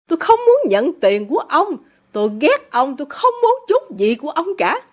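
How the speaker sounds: high, vehement refusal; defiant daughter. A narrator's reading. Daughter refuses all her father's money, defiant.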